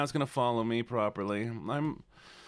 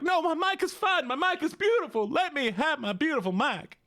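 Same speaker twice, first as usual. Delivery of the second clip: very high, squeaky protest; hammed-up high whine; mock-indignant protest; 13 semitones higher than usual. Comic protest about the mic.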